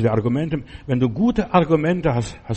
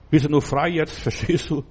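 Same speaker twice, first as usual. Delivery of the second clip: usual speaking voice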